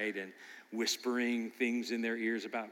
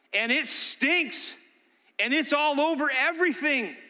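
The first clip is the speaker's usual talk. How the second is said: forceful preaching; 10 semitones higher, 6 dB louder than usual. Acted. Pastor urging the congregation, forceful preaching. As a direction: drive the lesson home.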